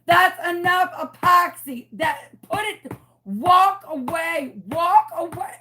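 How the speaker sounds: high loud orders, walk away dragged out; retold screaming. Screaming into the phone at the men, high and loud. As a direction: scream it to stop them. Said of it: "I'm literally screaming into the phone".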